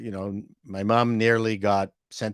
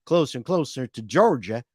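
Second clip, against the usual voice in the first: Georgia accent; 3 semitones higher than usual. Shown as a demonstration. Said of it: "then they sound like this".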